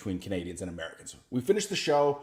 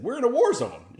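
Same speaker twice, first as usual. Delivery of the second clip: incredulous; 13 semitones higher, 6 dB louder than usual. Retold or imitated. Comic disbelief at war-zone coffee shop.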